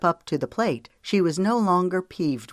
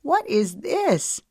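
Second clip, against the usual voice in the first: high, drawn-out amazed question; amused surprise; 6 semitones higher, slower than usual. A narrator's reading. Woman seeing family stride in with guitar, amused surprise. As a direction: ask it slowly and high, tickled and puzzled. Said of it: "Laughter gurgled in her throat".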